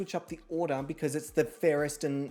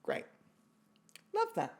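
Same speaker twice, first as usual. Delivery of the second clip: usual speaking voice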